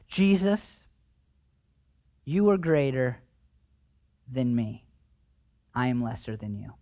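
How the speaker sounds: a quiet confession to Jesus; humble confession. Pretend.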